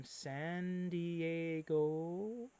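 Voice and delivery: long, lingering San Diego with a rising end; drawn-out name. Pretend. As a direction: stretch San Diego out very slowly. Playfully draws out the city name.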